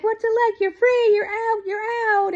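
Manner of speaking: high, gleeful cheering; thrilled family. Retold or imitated. Family greeting freed dad in car, high and gleeful. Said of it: "they're like".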